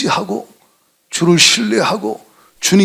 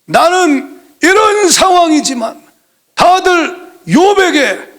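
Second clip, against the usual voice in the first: high and slow, each word stretched; a preacher straining upward at the climax; impassioned preaching; 10 semitones higher, 4 dB louder, slower than usual. Acted. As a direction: plead it with full fervour.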